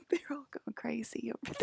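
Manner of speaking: unvoiced giggly whisper. Real, not acted. Telling viewers about the crazy spots in a laughing whisper. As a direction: breathe it out laughing.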